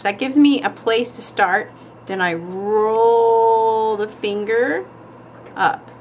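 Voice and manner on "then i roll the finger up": slow demo. Shown as a demonstration. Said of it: "I don't use my brush at all to draw".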